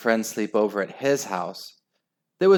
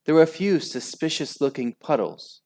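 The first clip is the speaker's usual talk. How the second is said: ordinary delivery, nothing marked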